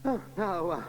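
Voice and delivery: a vicar soothing a rowdy church; lightly voiced vicar.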